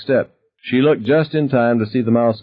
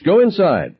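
sharper order; 10 semitones higher than usual. A narrator's reading. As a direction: push him out the door, harder now. Wife ordering husband away.

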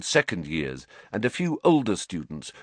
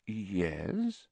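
slow; 9 dB quieter than usual. Acted. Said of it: "said Lupin slowly".

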